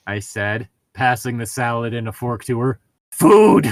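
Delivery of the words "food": high, loud eager cry for food; excited shout. Acted. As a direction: yell it like a starving kid. Reading fan fiction dialogue, eager.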